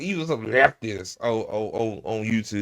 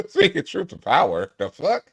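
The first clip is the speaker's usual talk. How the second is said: incredulous laughing repeat, pitched up; laughing disbelief; 8 semitones higher, 4 dB louder than usual. Real, not acted. Streamer laughing at senator's line, incredulous mocking echo. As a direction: scoff at the phrase through a laugh.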